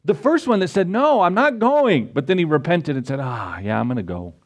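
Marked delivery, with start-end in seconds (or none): 0.9-2.1 defiant refusal. Acted. First son refusing. 3.2-4.3 sheepish giving in. Acted. Repentant son giving in.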